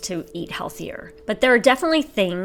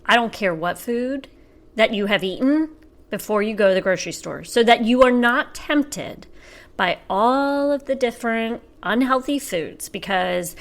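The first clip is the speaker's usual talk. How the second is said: ordinary talk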